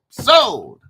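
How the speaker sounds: loud high auctioneer's sold. Pretend. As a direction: bang the gavel, shout it.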